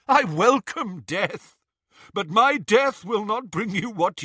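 put-on slow, loud defiant old voice; defiant old man. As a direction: challenge him without fear. Old man defying Voldemort.

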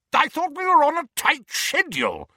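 loud, high-pitched impatient bellow; roaring. A narrator's reading. Uncle Vernon chivvying everyone to leave, roaring impatiently. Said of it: "roared Uncle Vernon".

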